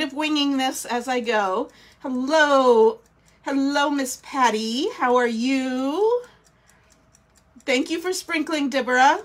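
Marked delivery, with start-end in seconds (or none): none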